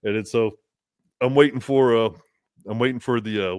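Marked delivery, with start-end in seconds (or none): none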